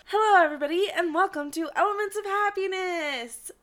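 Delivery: loud, bright show opener; podcast host intro. Acted. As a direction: welcome the listeners like a host.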